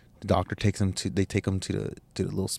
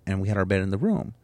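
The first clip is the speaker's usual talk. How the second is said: ordinary delivery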